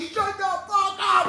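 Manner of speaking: high, slow, laughing shout; hammed-up shout through laughter; mock yell. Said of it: "He was like".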